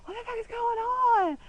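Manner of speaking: high panicked yell; screaming when the lights go out; retold scream. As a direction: yell in the dark in fright. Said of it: "and we were screaming".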